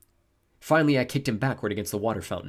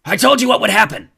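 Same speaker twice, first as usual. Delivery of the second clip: war god raging at full volume; furious high shout; angry bellow; 12 semitones higher, 13 dB louder than usual. A narrator's reading. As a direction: roar the reproach, pitch way up. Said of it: "the war god bellowed".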